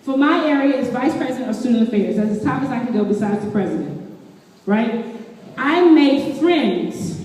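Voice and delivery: ordinary delivery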